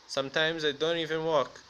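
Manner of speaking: ordinary delivery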